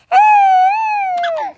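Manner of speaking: long, high, whining cry; high pretend wail. Child refused by dad, high whining wail. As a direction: let out a drawn-out child's wail. Lucy wails after being refused.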